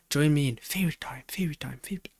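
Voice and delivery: a secretive little hush that trails away; playful whisper. Pretend. Whispering to viewers to join theory time. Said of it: "inviting viewers to theory time".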